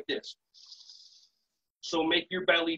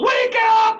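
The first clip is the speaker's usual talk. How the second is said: very high, loud mock kids' shout; kids yelling; 11 dB louder than usual. Kids shout at their dozing teacher to wake up, high yell. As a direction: yell it like a roomful of children. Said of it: "all the kids yell".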